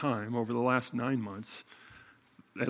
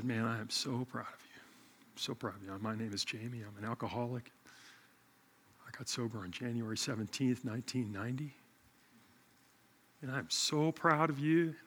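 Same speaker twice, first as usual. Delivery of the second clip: heartfelt pride; 8 dB quieter than usual. Retold or imitated. Retold tender words to his friend.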